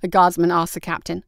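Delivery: ordinary delivery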